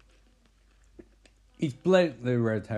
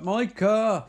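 mock-furious higher-pitched call; 7 semitones higher than usual. Girlfriend calls her boyfriend from the bathroom, mock furious. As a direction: call out in imitated fury. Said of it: "she's furious".

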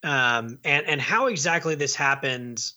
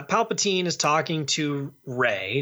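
normal talking voice

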